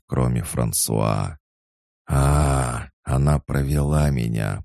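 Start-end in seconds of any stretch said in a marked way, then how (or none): none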